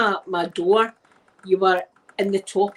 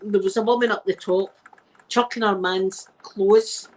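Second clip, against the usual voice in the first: usual speaking voice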